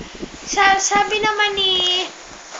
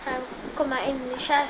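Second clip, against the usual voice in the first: usual speaking voice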